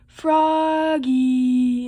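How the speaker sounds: long drawn-out calling yell; loud, sustained storybook shout; mother's yell. Acted. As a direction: holler the name through the house, stretching it out. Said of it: "yelled his mother".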